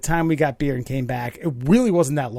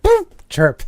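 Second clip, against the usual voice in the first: high, loud tire-chirp sound effect; a quick high chirp sound, a playful car noise; engine chirp imitation; 8 dB louder than usual.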